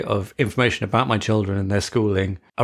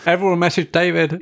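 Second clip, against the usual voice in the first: raised, higher call to everyone; playful loud shout-out; mock announcement; 8 semitones higher, 4 dB louder than usual. Teasing cohost calls out to the listeners, mock announcement.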